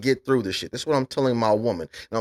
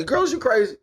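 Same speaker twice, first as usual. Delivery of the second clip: exasperated outburst; 12 semitones higher than usual. Real, not acted.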